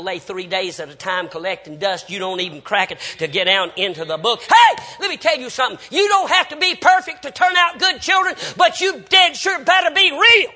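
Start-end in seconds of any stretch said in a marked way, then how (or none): 4.4-10.5 loud, high, full-force preaching; preacher's shout. Real, not acted. Preacher exhorts parents about raising good children, shouting hard. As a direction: holler it out to the back pew.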